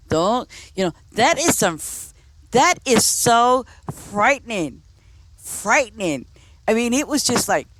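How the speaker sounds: creeped-out outburst. Real, not acted. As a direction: let the disgust and alarm spill out.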